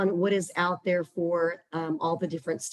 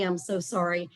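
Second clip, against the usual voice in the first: ordinary talk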